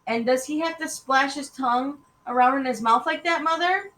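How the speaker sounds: high, rising complaint; exasperated child. Acted. Daughter to Mother about the dog's noisy tongue, exasperated whine.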